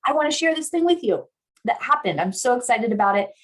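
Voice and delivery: high-pitched, eager sharing; excited. Acted. Telling a partner something exciting, excited. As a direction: burst in with good news. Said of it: "I'm telling them something exciting".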